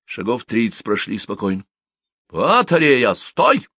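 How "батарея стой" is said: sudden high loud halt order; barked command. Acted. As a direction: bark the halt like a sergeant. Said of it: "неожиданно рявкнул Горышич".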